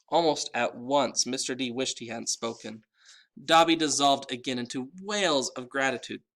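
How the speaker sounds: usual speaking voice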